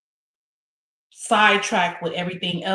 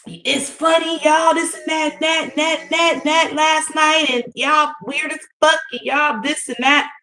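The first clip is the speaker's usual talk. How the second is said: a higher mocking imitation; mocking May; 8 semitones higher, slower than usual. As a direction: mimic her mockingly. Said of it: "'Cause May had said".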